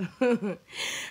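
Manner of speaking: loud burst of laughter. Real, not acted. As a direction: laugh out suddenly.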